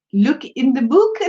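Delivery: bad mock-British accent; botched British accent, breaking into laughter; attempted British accent. Shown as a demonstration. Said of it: "I can't say it".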